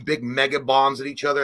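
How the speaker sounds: normal talking voice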